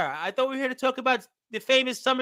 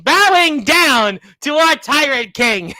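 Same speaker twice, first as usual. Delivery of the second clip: slow, louder herald-style announcement; mock royal proclamation; 3 semitones higher, 7 dB louder, slower than usual. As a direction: proclaim it like a court herald, drawn out. Mock proclamation to a tyrant king.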